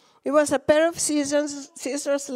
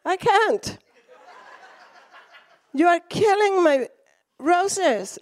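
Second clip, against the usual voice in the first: high, laughing protest; upset protest; 4 semitones higher, slower than usual. Retold or imitated. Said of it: "I looked at him and I said".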